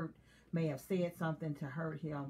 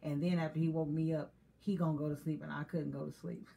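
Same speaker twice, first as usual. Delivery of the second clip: normal talking voice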